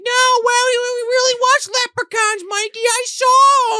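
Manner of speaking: put-on very high, loud girl voice; excited little girl. Melanie tells Mikey she saw leprechauns, very high. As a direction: insist on it like a thrilled little girl. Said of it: "Melanie's like".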